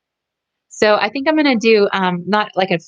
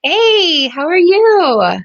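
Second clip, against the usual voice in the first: high, loud, slow hello; bright call-out to a friend; excited greeting; 11 semitones higher, 6 dB louder, much slower than usual. Real, not acted.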